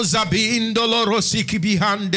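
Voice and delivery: high, intense tongues prayer; speaking in tongues. Acted. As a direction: pray in tongues with fervour. Tongues, raised pitch.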